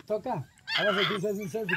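ordinary delivery

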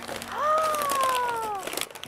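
long high-pitched gasp; amazed gasp. Pretend. Opening the package for viewers, amazed gasp.

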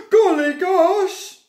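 loud, high, slow exclamation; hammed-up shocked farmer; startled farmer. A narrator's reading. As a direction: cry out in storybook astonishment. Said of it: "the farmer woke and said".

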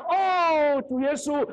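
loud, high cry of "哦，主耶稣"; a believer crying out to Jesus in prayer; calling out. Shown as a demonstration. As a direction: cry out to the Lord, full voice. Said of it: "当你喊".

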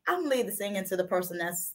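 ordinary talk, nothing marked

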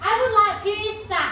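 I would like pizza with a stretched would; slow example sentence. Shown as a demonstration. Teacher shows the class the sentence to say, slowly. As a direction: slowly show the answer. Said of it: "บอกว่านักเรียนชอบอาหารชนิด".

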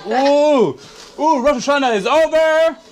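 usual speaking voice

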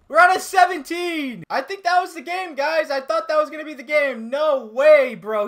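high, loud lament; upset protest. Real, not acted. Gamer telling viewers the streak is lost, upset protest.

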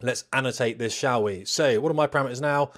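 ordinary talk

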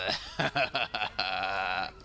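long villainous laugh; drawn-out mischievous laugh; cackle. Pretend. Laughs while fleeing police.

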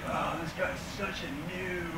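soft, dismissive sneer; softly scoffing gamer; bored nerd. Retold or imitated. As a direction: toss it off like it's nothing.

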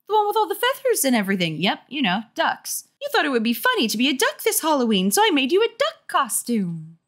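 high, eager mom voice; insistent mother. Acted. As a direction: explain it like a perky mom.

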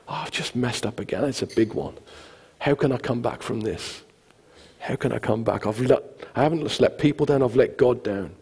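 soft, low, dejected self-talk; understated acted despair; despondent. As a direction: quietly sink into self-blame. Voicing someone who feels they failed.

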